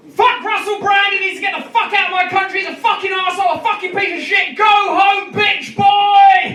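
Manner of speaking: high, furious reading shout; shouting in capitals. Shown as a demonstration. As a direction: yell it like all caps. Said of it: "When I talk loudly, it means it's in capitals".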